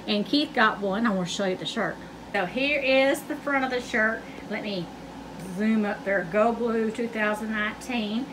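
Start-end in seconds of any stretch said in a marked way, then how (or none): none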